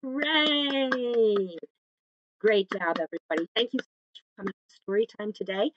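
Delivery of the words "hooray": higher, drawn-out cheer falling away; long singsong hooray; celebratory cheer. Real, not acted. Cheering children at song's end.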